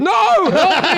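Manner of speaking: high, loud desperate yell; comic high-pitched plea; mock cry for help. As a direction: shout for rescue, hammed-up panic. Acts out begging for rescue.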